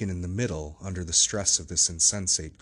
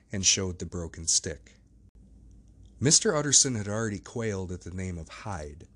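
ordinary delivery, nothing marked

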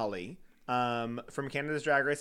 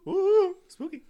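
high, loud hammed-up ghost hooting; spooky ghost voice; 7 dB louder, slower than usual. Shown as a demonstration. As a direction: play a cartoon ghost, pitched way up. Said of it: "Make it like, ooh, spooky".

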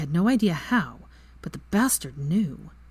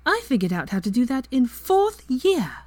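higher, louder sharp retort; snapped; 8 semitones higher, 5 dB louder than usual. A narrator's reading. Hermione snapping at Lucius after her spell, sharp and loud. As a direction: snap it back proudly. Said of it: "she snapped".

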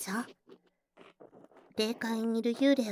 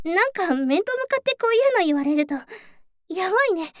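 louder, giddy and overwhelmed by praise; flustered; 4 semitones higher, 7 dB louder than usual. Real, not acted. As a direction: caught off guard by a compliment.

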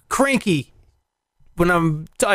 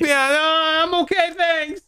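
high, mock-polite voice; nervous refusal; 11 semitones higher than usual. Sleepy guest refusing a stranger at the door, high nervous imitation. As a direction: act out a timid no through the door.

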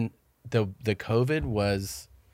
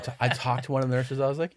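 ordinary talk

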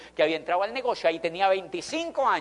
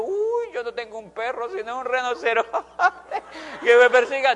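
laughing joke; 5 semitones higher, 6 dB louder than usual. Retold or imitated. Jokes about his rhinoceros.

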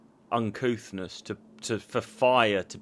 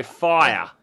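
one long booming posh "Fire"; "Fire" drawn out, hammed-up and grand; Matt Berry fire; 7 dB louder than usual. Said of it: "It's like Matt Berry".

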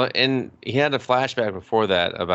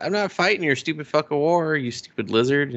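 indignant retort, pitched up at first; mock outrage in an acted quote; indignant refusal; 6 semitones higher than usual. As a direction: refuse like you're fed up, voice raised in pitch. Acted angry refusal of a fight.

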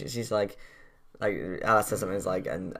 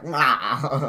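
goofy Higgins roar; 4 semitones higher, 5 dB louder than usual. Retold or imitated. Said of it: "Higgins just being like".

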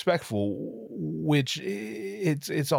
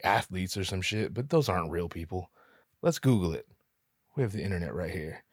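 usual speaking voice

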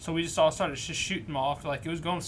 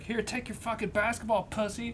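higher-pitched imitation of her yelling; raised-pitch mock yell; mock angry yelling; 6 semitones higher than usual. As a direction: imitate her yell without really shouting. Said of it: "you can hear her start yelling".

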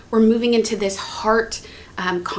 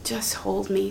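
soft plea; 2 semitones lower, 9 dB quieter than usual. Shown as a demonstration. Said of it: "Sometimes words".

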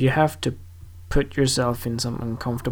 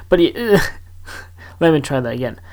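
ordinary talk, nothing marked

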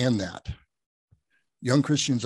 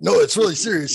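insistent; 7 semitones higher, 8 dB louder, faster than usual. Retold or imitated. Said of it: "I'm like".

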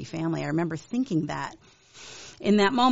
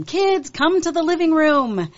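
put-on raised, higher call to the kids; 9 semitones higher, 6 dB louder than usual. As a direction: call them in from the next room, louder and higher. Mom calling kids from room.

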